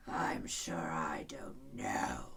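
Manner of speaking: growling lion. A narrator's reading. Lion growling at the king, low and slow. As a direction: rumble it lazily. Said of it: "the lion growled out".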